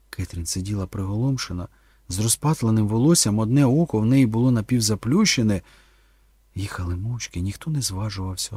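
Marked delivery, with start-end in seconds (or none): none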